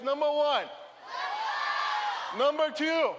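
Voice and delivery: number one called out with conviction. Shown as a demonstration. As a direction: say it like you mean it. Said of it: "Say it like you mean it".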